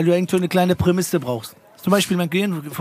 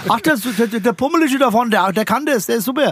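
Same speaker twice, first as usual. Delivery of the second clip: put-on higher, excited pointing at the chubby kid; mock eager parent spotting the boy; parent in the stands; 6 semitones higher than usual. Spectator pointing out the chubby boy in front, mock eager.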